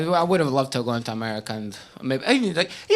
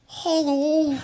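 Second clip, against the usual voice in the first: nervous hello; 11 semitones higher than usual. Retold or imitated. Nervous greeting to old ladies.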